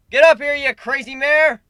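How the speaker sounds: high, loud playful shout; calling out. Acted. Narrator calling Pinkie Pie up, shouting. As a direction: holler her onto the stage.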